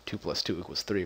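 normal talking voice

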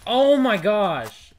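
loud drawn-out shout; shocked shout. Real, not acted. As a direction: yell it out slow and loud. Sudden game shock, shouted.

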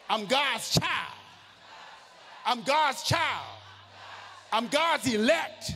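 slow declaring shout. Shown as a demonstration. Preacher leads the congregation, slow proclaiming shout. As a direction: proclaim it like a battle cry. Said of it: "So tell somebody".

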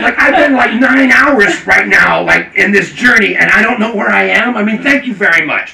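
loud, high rant to an official; re-enacted frazzled outburst; freaked out. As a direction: protest loudly, at the end of your rope. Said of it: "I'm a little freaked out".

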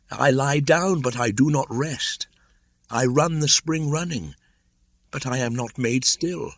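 put-on high anxious boy voice; a restless, troubled young boy; feverish boy. Mowgli pours out his trouble.

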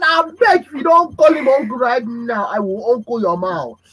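put-on father scolding a cheeky child; mock parental threat. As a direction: warn the child sharply.

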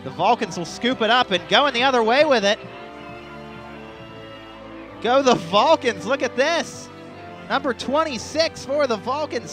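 excited call. Real, not acted. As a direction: call the return as it happens. Blocked kick returned.

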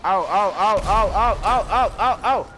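loud rising oh chant; excited oh oh. Real, not acted. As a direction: build up the ohs.

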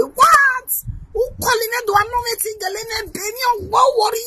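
very high, put-on incredulous voice; mock outraged squeal. Commentator acting out a reaction to the story, high mock shock. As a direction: squeak out the questions in mock disbelief.

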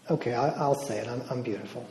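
quiet and hesitant, barely committing; timid, unconvinced. Retold or imitated. Deborah, prompted by the speaker, quietly hesitant. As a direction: say it like you don't believe it yet. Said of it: "say it like you mean it".

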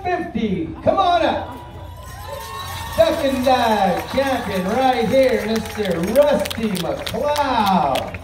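booming arena announcer call; powwow emcee. Real, not acted. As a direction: announce the champion to the crowd.

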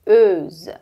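"euse" said loud as "øz", rounded vowel then a buzzing z; loud, clear "euse" ending. Shown as a demonstration. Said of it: "tá?".

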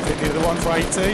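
fast, high, loud callout; excited callout. Real, not acted. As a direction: bark the damage number out quick. Calling out damage mid-fight.

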